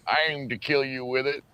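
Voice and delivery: lightly put-on Southern character voice; Sling Blade impression. Host replaying the lawnmower blade scene, Sling Blade impression. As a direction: answer as the Sling Blade man. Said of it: "what are you doing with that lawnmower blade".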